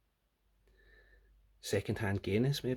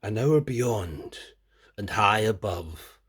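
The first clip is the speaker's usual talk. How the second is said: poetic reading; 6 dB louder, slower than usual. Shown as a demonstration. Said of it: "Should I say it in Scottish?".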